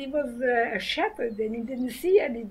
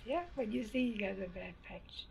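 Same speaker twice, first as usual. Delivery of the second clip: much softer, quieter restatement of bad patch; softer repeat; 4 semitones lower, 11 dB quieter than usual. Shown as a demonstration. Repeating her lesson to the interviewer, softer. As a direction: say it again, gently and low. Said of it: "repeats the phrase".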